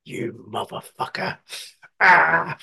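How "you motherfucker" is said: slow whispered curse; slow hushed curse at the bladder; mock whispered curse. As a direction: breathe the curse out under your breath. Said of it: "I'm like".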